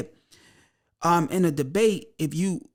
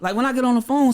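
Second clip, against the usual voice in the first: ordinary delivery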